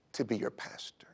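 whispered confession. Retold or imitated. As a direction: drop to a soft whisper. Catherine confessing her divorce.

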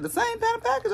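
high, surprised question. Retold or imitated. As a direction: squeak it out in disbelief. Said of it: "They said".

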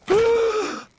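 loud, high gasp of shock; sharp gasp. Acted. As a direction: gasp as if stunned. Sudden shock.

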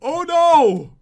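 loud, high cry of mock dismay; alarmed cry. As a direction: cry out as disaster strikes in a game. Sudden bad news in game.

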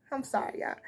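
soft laugh into a quick sorry; quiet, amused sorry; laughing apology. Real, not acted. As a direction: giggle while apologizing for the slip. Laughs apologizing for her slip.

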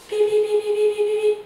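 high, loud ringing beeps; a loud high alarm beeping from headquarters. Acted. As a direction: ring like the radio call. Headquarters calling signal.

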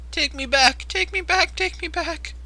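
high, whiny pleading wail; hammed-up whiny wail; mock pleading wail. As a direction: beg to be taken home like a kid. Pleading repeatedly to go home.

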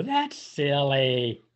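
plain reading voice

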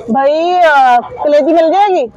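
asking the vendor loudly for liver; calling out. Real, not acted. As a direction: call across the street to the vendor. Calling shopkeeper across street.

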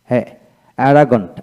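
ordinary delivery

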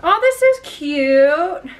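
slow, high, loud delight; drawn-out delighted coo; excited gush. Real, not acted. Gushing over a gift.

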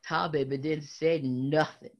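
ordinary delivery, nothing marked

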